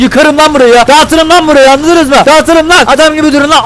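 high-pitched angry outburst; threatening rant. Acted. Driver threatens road workers blocking lanes, angry. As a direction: threaten them, pitched right up.

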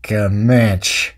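very slow, deep western drawl; John Wayne. Acted. Uncle identifying the Comanche spear, very slow low drawl. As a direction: drag every word out, low and deliberate. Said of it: "doing an impression of John Wayne through my stupid cowboy".

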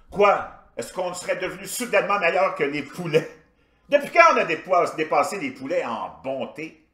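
loud, high-pitched incredulous questioning; animated mock indignation, pushed high and loud; stand-up rant. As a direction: fire off absurd questions like an outraged comic. Performs his version of a stand-up routine.

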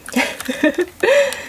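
light high-pitched giggle. Real, not acted. Vlogger remembering school lunch, high giggle. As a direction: giggle at the memory.

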